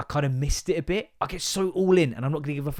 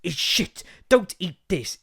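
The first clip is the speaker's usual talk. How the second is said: mock food critic; 6 semitones higher, slower than usual. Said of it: "dude who goes around supermarkets like".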